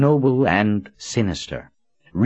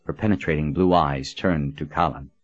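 ordinary delivery

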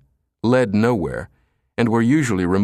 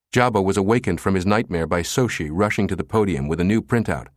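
usual speaking voice

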